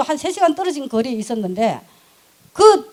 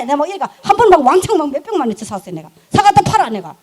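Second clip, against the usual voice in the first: ordinary talk, nothing marked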